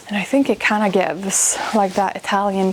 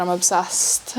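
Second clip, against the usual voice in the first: ordinary delivery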